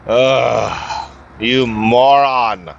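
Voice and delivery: slow, drawn-out disgusted put-down; contemptuous insult. Real, not acted. Driver scorning the car on his tail, slow and disgusted. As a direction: drag out your scorn.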